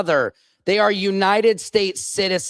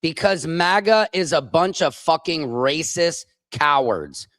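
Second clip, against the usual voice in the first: normal talking voice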